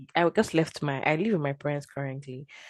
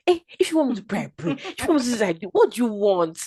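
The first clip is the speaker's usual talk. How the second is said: raised, pleading demands to God; exasperated demand; 6 semitones higher, 6 dB louder than usual. Retold or imitated. Frustrated with God over the job, demanding. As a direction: challenge God, higher and louder.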